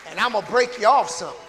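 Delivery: a hint of a stern parent warning. God as parent scolding a child, stern warning.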